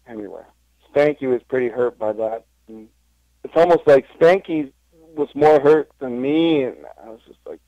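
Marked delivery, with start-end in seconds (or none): none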